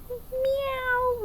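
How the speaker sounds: high meow; kids' teacher meowing; cat meow. Shown as a demonstration. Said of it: "What does a cat say?".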